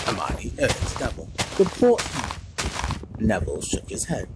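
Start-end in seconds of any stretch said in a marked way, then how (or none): 1.6-3.3 slow, insistent urging; urging firmly. A narrator's reading. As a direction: press him to act. Hermione urging Neville.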